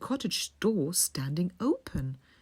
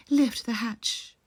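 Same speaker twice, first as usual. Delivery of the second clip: grandmother calling out; 5 semitones higher, 3 dB louder, slower than usual. A narrator's reading. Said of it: "called out the grandmother".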